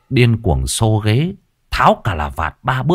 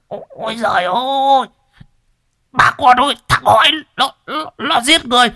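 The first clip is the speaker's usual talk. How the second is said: very high, loud panicked screaming; choking cries for help; 3 dB louder than usual. Acted. Đồng being choked begs villagers, shrill panicked screams. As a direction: squeal for help like a strangled old man.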